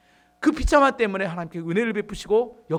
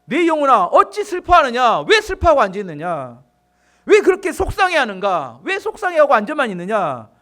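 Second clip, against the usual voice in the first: commanding; 3 semitones higher, 6 dB louder than usual. Acted. David commands his own soul, commanding. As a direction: order your own soul forcefully. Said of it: "자기 영혼에게 다윗 스스로 명령합니다".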